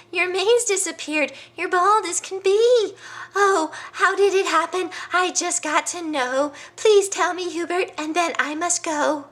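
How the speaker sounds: high, loud, fussy bird voice; nosy bird. Acted. Busybody bird pestering the bald lion, high and loud. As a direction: chatter at him like a busybody hen.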